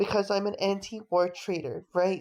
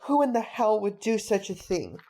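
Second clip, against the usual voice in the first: ordinary talk, nothing marked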